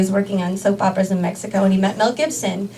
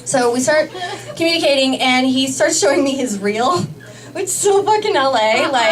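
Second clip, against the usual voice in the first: ordinary talk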